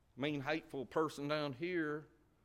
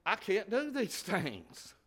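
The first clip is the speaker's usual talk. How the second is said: high, loud pleading voice; imitating a struggling believer; 10 semitones higher, 11 dB louder than usual. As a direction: play the doubter begging to be excused. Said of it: "You might say on the other side".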